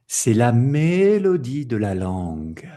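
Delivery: "c'est la mélodie de la langue" swelling up on "mélodie", then gliding down; melodic contour. Shown as a demonstration. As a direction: draw "mélodie" out in a rising and falling arc. Teacher illustrates melody with voice.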